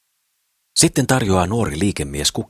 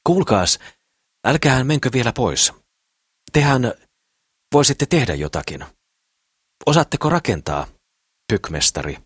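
calling out; 7 semitones higher than usual. Acted. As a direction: shout to stop him leaving. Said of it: "ja huutaa". (huutaen perään)

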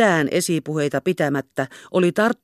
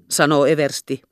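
ordinary delivery

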